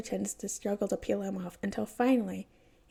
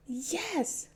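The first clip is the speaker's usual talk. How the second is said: put-on excited kid shout; 7 semitones higher than usual. A narrator's reading. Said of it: "the kid screams".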